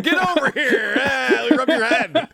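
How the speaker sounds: mock condescending, laughing. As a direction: talk down to a child, chuckling. Mocking guard's condescension.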